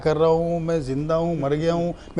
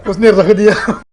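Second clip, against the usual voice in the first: laughing out words; 4 semitones higher, 11 dB louder, slower than usual. Real, not acted. Laughing while telling.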